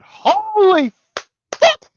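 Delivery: stunned, high yell of amazement; excited shout. Real, not acted. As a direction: blurt it out in shock. Stunned reaction to the scene.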